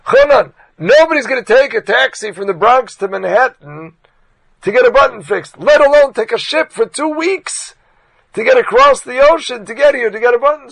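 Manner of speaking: loud, high disbelieving argument, slowing at the ship; exasperated shout. Retold or imitated. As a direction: shout the absurdity at him. Philip arguing in disbelief.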